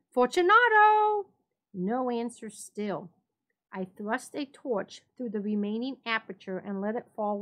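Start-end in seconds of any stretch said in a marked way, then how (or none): 0.1-1.3 much higher, louder long call; calling aloud. A narrator's reading. Montresor calls aloud to Fortunato behind the wall. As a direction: call his name into the dark niche. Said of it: "I called again".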